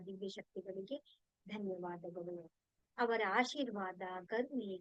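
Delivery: subdued, measured devotional recitation; prayer of thanks. Real, not acted. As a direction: recite a litany of gratitude, each line settling low and soft. (ಶಾಂತವಾದ ಪ್ರಾರ್ಥನೆಯ ಧ್ವನಿ)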